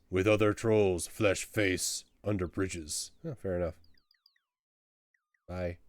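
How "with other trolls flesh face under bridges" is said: blunt troll, scornful of a dim question. Acted. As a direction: state the obvious like a troll. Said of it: "He stares at you oddly and says".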